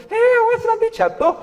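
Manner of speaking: high, alarmed warning; anxious mother warning. Retold or imitated. Mother to child climbing high, high alarmed warning.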